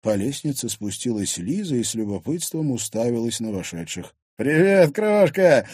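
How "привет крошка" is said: loud, high swaggering greeting; cheeky thug. Acted. Young tough greeting the girl, cheeky. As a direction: greet her with brash familiarity. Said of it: "подмигнул ей рыжий".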